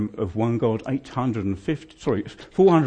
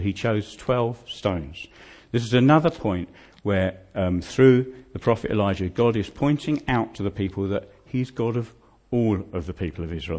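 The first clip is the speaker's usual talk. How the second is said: normal talking voice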